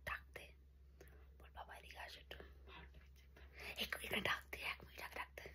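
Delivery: urgent whispered prompting; whispering. Real, not acted. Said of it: "আমরা আস্তে আস্তে কথা বলছি".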